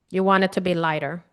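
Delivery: normal talking voice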